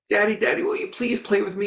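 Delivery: put-on high, pleading little boy voice; whiny little son tugging at his busy father; pleading child. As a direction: beg like a small bored boy. Son begs father again.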